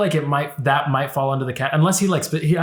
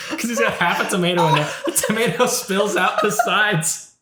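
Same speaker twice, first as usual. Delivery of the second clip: high-pitched words squeezed out through laughter; laughing hard; 6 semitones higher than usual. Real, not acted. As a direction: barely finish it for laughing. Cracking up over the sandwich.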